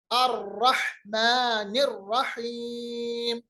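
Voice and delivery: slow, elongated Quran recitation; tajweed recitation. Shown as a demonstration. Teacher reciting the verse for students, slow tajweed. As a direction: hold the long vowels of "अर्रहमानिर्रहीम".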